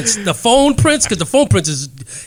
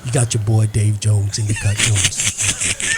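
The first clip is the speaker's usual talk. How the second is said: much lower hype-man intro, drawn out; Dave Jones impression; 10 semitones lower, slower than usual. [name] introducing the co-host Dave Jones, deep hype intro. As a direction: drop way down and hype him in. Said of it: "all these, inter-, you know, these, uh, impersonations".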